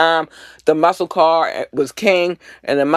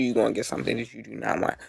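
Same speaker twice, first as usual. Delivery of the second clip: menacing low warning; 4 semitones lower, 9 dB quieter, faster than usual. Real, not acted. Repeated warning, fast and quiet.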